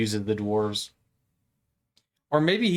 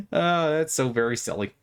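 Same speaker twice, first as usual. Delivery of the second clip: normal talking voice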